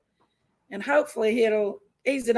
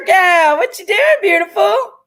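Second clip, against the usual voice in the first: excited call-out; 6 semitones higher, 9 dB louder than usual. Real, not acted. Loud greeting to viewer.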